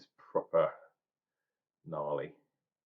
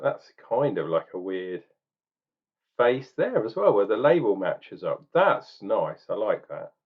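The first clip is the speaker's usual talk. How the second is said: normal talking voice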